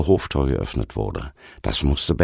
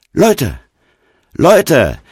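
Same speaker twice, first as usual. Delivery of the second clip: high-pitched, loud announcement; sudden calling out; 7 dB louder than usual. A narrator's reading. Stranger revealing who he is to the group, calling out. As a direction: call everyone to attention. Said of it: "plötzlich rief er".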